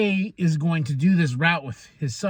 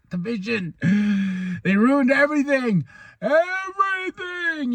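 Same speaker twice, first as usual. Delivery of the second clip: mock angry rant; 8 semitones higher than usual. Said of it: "She's mad. She's angry".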